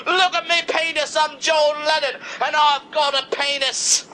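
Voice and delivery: crowing, showing off. Acted. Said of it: "[name], nineteen seventy-nine".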